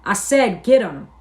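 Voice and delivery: loud, insistent order; yelling. A narrator's reading. George repeating his order to Lenny, yelling. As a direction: punch out the command impatiently. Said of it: "George yelled again".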